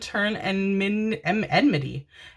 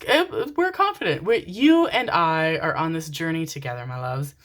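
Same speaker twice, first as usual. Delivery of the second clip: ordinary delivery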